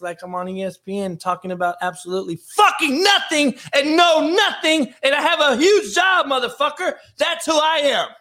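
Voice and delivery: hammed-up shouting tirade; mock ESPN rant shout. Shown as a demonstration. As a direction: blow up like a pundit losing it on air. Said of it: "I can roll my hands like this".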